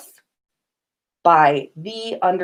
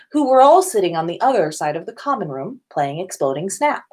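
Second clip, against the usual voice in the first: usual speaking voice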